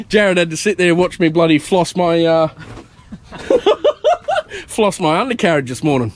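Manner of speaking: giggling through the words; laughing. Real, not acted. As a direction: laugh while telling it.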